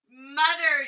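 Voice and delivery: anxious, loud call of Mother; worried. A narrator's reading. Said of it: "she said in a worried voice".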